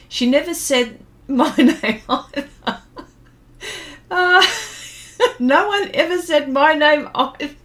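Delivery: laughing through a mock grievance; amused complaint, cracking up. Real, not acted. Laughing over childhood grievance with chat.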